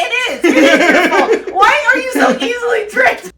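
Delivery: laughing shout. Real, not acted. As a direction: yell the blame while cracking up. Mock blaming the tricked.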